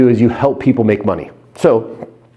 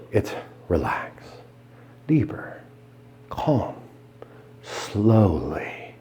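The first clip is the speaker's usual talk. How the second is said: softer, breathy trance words; hypnotic whisper; 4 semitones lower, 12 dB quieter, slower than usual. Shown as a demonstration. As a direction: breathe each word out slowly. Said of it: "Now remember".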